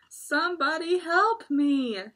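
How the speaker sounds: loud, high mock cry for help; trapped tiger calling for help; shouting. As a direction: yell for help, hammed up. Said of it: "shouted Tiger".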